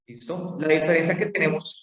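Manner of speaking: normal talking voice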